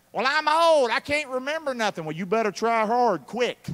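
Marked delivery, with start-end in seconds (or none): 0.1-2.0 high, loud mock old-timer excuse; whiny excuse maker. Pastor mocking a churchgoer's excuse, high whiny voice. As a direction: play the lazy churchgoer's excuse.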